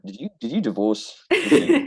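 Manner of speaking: mock blunt Russian, the last "Did you?" jumps high and loud; blunt Russian question. Imitating a blunt Russian asking a friend about his divorce, mock-direct. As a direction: fire the question straight at them, teasing. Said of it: "whereas the Russian's like".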